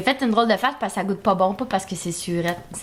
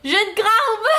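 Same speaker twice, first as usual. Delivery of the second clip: laughing, higher cry of sour pain; eating sour candy; 13 semitones higher, 4 dB louder, slower than usual. Real, not acted. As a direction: jaw cramping from sour, laughing. Sour candy cramps jaw.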